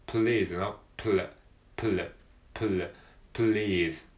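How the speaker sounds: プル said with a clear L as in please; pl drill. Shown as a demonstration. Teacher drilling L for please. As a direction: repeat the pl sound. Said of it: "全然違いますよね".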